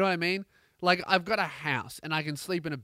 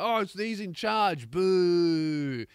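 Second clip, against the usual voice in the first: raised voice, long booing finish; mock yelled heckle; 3 semitones higher, 4 dB louder, much slower than usual. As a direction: jeer like a sports crowd. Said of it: "the only time we'll ever yell".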